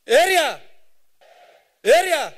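loud drawn-out call to the audience, repeated; crowd call-out. Real, not acted. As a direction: shout it out to rally the room. Calling out to the crowd.

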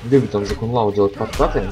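ordinary talk, nothing marked

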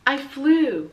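high, loud wonder; Ulysses amazed. Acted. Ulysses the squirrel amazed at himself, high and loud. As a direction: marvel at his own flight.

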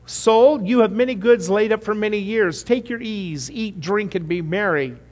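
loud, high, self-satisfied rich man; the greedy farmer telling his soul to relax, smug and loud; rich fool to his soul. Acted. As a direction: boom it out like a man pleased with himself. Preacher voicing the rich fool.

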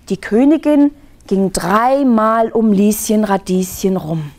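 ordinary delivery, nothing marked

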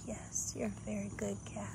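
soft whispered praise to the cat; whisper to a pet. Real, not acted. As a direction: whisper it tenderly to the kitten. Whispering to kitten.

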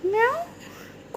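high, slow drawn-out meow; long squeaky kitten meow; cat meow. Shown as a demonstration. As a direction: mew like a kitten for the toddler. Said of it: "పిల్లి ఏది? Cat".

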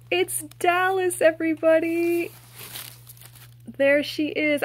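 high, loud, delighted announcement; excited reveal. Real, not acted. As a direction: present the big surprise to everybody. Reveals the second toy with delight.

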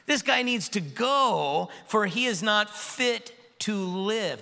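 high, slow crowd cry; a mob calling out slowly. A narrator's reading. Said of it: "they raised their voices and said".

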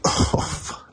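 breathless pained curse; hissed-out curse through the sting; stubbed toe. Real, not acted. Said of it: "I just stubbed my toe".

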